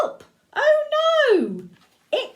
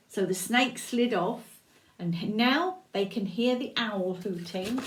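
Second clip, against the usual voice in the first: ordinary delivery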